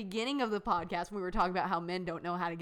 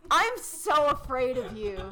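mock fear; 5 semitones higher, 5 dB louder, slower than usual.

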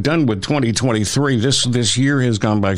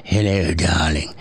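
sultry lady voice; 7 semitones lower than usual. Retold or imitated. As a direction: purr it like a glamorous lady. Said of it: "Virginia Slims was".

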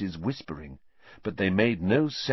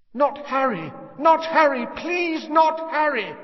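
high, loud pleading woman's voice; desperate screaming plea; 4 dB louder than usual. A narrator's reading. A mother begging the killer to spare Harry, desperate screams. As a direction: beg for the child's life, terrified. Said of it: "Someone was screaming".

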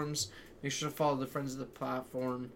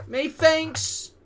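even higher, louder methinks; mock British accent; 14 semitones higher, 8 dB louder than usual. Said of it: "in that same voice".

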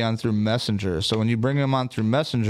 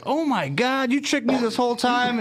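high-pitched mock female amazement; astonished girl; 12 semitones higher than usual. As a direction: imitate her shock, voice way up high. Retells the tricked girl's astonishment.

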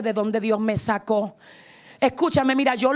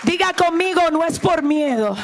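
raised, high-pitched pulpit cry; impassioned preaching; 6 semitones higher than usual. Real, not acted. Preacher urging the church to adore and never leave God, impassioned preaching. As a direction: preach with fervor, building to a shouted call.

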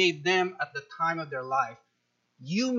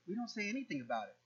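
dismissive hush; 12 dB quieter, much faster than usual. Retold or imitated. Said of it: "selective indignance".

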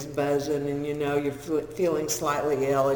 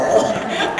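burst of laughter; 8 dB louder than usual. Real, not acted. Laughing at own story.

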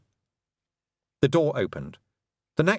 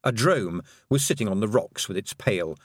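ordinary delivery, nothing marked

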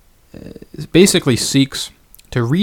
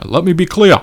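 higher, louder impression of Bernie Sanders' Brooklyn accent; Bernie Sanders accent; 6 semitones higher, 5 dB louder than usual. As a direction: put on Bernie's Brooklyn accent, raised and loud. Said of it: "maybe I should".

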